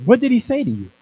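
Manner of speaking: high, loud nosy little sister; urgent question. Retold or imitated. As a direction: demand to know what he said. Sister asks what was said.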